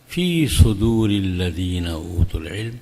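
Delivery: slow, low intoned Arabic; Quran recitation. Acted. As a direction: draw the verse out reverently. Recites verse fragment.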